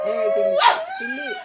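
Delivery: very high, loud call to the pet. Real, not acted. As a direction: squeal the name up high to call her. Calling the pet playfully. (खूप उंच आवाजात टिल्लीला हाक)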